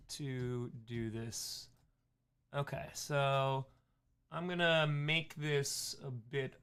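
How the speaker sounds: slow, low droning teacher voice; droning teacher impression. Shown as a demonstration. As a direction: drone it out like a bored lecturer. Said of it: "my teacher voice".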